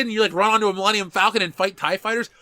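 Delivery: real excitement spilling over; excited. Real, not acted. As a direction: get carried away by the daydream. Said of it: "I'm getting excited thinking about it".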